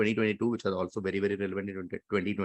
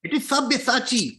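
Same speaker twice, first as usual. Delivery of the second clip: high-pitched, triumphant announcement; excited reveal; 12 semitones higher, 4 dB louder than usual. Real, not acted. Quiz host confirming a contestant's correct answer, excited.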